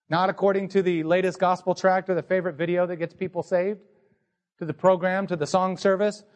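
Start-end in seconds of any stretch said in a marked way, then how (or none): none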